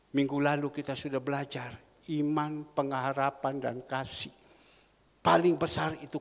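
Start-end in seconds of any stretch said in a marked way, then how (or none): none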